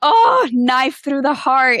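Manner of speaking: slow, high and loud, a theatrical wail of grief; milk the heartbreak, drawn out; dramatic heartbreak. Retold or imitated. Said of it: "and I was like".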